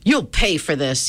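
ordinary delivery

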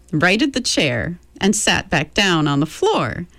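ordinary delivery, nothing marked

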